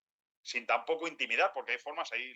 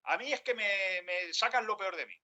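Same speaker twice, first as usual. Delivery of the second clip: high, blaming reproach; acted reproach, much higher; reproachful; 8 semitones higher than usual. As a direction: accuse the other of always being like that. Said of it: "No es lo mismo decirte".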